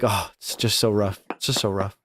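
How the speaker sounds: subdued mock mourning; hushed, mildly hammed-up sorrow; grieving family. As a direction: play a relative quietly lamenting. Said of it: "the family would be like".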